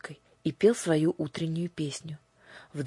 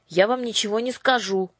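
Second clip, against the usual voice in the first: defiant; 6 semitones higher, 4 dB louder than usual. A narrator's reading. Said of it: "в один голос ответили".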